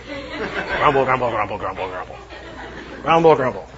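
playful grumble sounds with a laugh; low mocking mutter; mock grumbling. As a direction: grumble it out comically.

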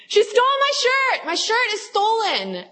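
very high, drawn-out mock shriek; exaggerated outraged sibling shriek; imitated sister's yell. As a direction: wail an accusation down from upstairs, hammed up. Imitates sister yelling from upstairs.

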